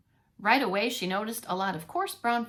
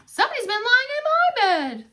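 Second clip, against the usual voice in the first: higher and louder motherly voice; Mama Bear's outraged complaint; 13 semitones higher, 7 dB louder than usual. Acted. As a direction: say it indignantly as Mama. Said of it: "said Mama".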